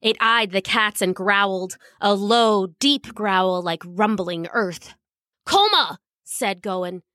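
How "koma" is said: sharp high warning call; urgent warning shout. Acted. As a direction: warn her off fast. Cat warning friend of dog.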